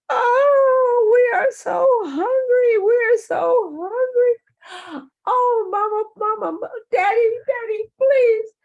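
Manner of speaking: put-on very high, loud baby-rabbit wailing; mock crying. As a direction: bawl and beg your parents like hungry little ones. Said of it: "the rabbits are crying again".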